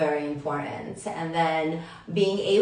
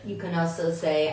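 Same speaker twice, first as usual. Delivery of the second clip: ordinary talk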